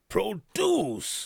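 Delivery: Produce said high and hard, drawn out; stress on Produce. Real, not acted. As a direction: punch the word Produce like a scolding. Scolding producer, repeats key word.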